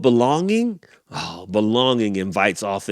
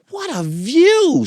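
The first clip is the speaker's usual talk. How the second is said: very high, slow, amazed; awed leopard; 5 dB louder, slower than usual. Acted. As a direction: marvel at it slowly and high. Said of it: "says the leopard".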